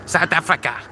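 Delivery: high-pitched put-on South African accent; mock South African accent. Streamer mimicking where Musk is from, mock South African accent.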